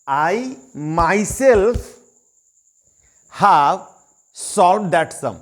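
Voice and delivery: "myself" and "have" stressed, with pauses between; emphatic, word by word. Shown as a demonstration. Student tells sir he solved it, word by word. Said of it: "तो इसका मतलब क्या हो गया".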